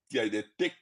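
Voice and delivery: ordinary talk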